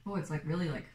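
chewing while talking; words muffled by food; mouth full of cookie. Real, not acted. Seen 1: Man bit cookie at -5s; jaw still chewing during words.

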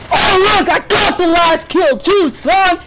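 high, gloating victory yell; excited gamer boasting; triumphant shout. Real, not acted. Boasting after the winning kill.

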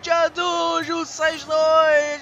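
loud, slow stunned exclamation; excited shouting. Real, not acted. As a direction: shout in disbelief at the result. Last-second goal excitement.

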